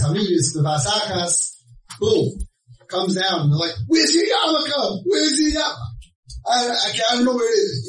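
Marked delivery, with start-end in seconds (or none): 3.9-6.0 mock parental yell, pitched way up; alarmed confrontation. Parents shocked, confronting the son. 6.5-7.9 high, quick stammered excuse; mock-flustered denial, pitched up. Son stammers when confronted by parents.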